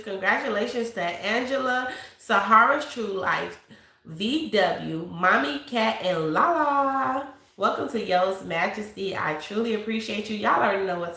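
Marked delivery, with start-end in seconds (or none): none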